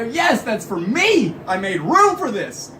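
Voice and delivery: high, slow, jubilant announcement; retold elated cry; triumphant claim. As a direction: celebrate a long-awaited delivery. Retold celebrating the pizza's arrival.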